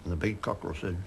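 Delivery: ordinary talk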